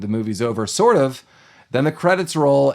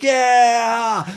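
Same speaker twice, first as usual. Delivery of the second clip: high, drawn-out imitation cheer; mock crowd cheer, pitched way up; 10 semitones higher, 3 dB louder than usual. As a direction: mimic a whole crowd celebrating. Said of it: "everyone was like".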